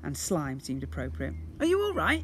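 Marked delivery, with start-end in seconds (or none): 1.6-2.2 higher, louder and anxious; worried boy; worried call. Acted. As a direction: call out full of concern. Ned worried calling.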